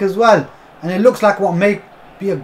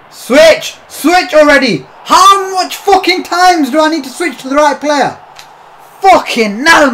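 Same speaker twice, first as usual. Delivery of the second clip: real angry shout, much higher and louder; furious yelling; 9 semitones higher, 6 dB louder than usual. Real, not acted. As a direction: bark at the game to switch. Yelling at the controls.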